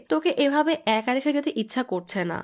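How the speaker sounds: ordinary delivery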